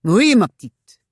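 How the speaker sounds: annoyed French husband. A narrator's reading. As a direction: answer your wife testily in a French accent. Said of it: "annoyed that his wife should find".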